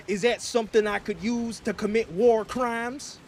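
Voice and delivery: a lightly put-on American president accent; hammed-up American accent. President persona talking to his viewers, in a put-on American accent. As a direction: play a blustering American president, accent laid on. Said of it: "this little accent that we got".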